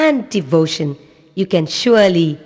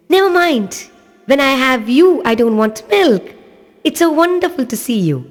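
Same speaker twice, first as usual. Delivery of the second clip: much higher and louder, bubbling over; joyful little boy; 8 semitones higher, 4 dB louder than usual. Acted. Little boy telling Lord Shiva he wants only him, joyful. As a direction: gush with a child's delight.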